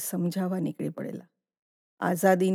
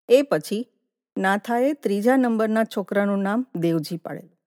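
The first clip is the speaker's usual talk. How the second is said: usual speaking voice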